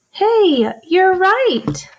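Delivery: high, loud delighted cheer; excited praise. Real, not acted. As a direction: celebrate the right answer. Praising students.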